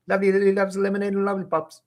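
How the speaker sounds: high, rushed race through the twister; very fast. Shown as a demonstration. Teacher showing off top speed. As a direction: fire it off as fast as possible. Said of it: "fastest take".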